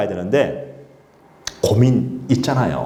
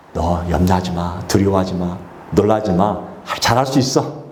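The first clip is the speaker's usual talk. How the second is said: reassuring God; 6 semitones lower than usual. Acted. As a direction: comfort a frightened leader.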